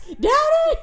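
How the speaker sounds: high, loud mock scream through laughter; hammed-up high yell; imitated scream. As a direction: mimic a panicked woman screaming next door.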